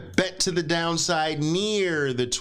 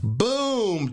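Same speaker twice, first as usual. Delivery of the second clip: boom, shot out much higher; excited boom; 7 semitones higher than usual. Real, not acted. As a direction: call the trade like a win. Excited trade call.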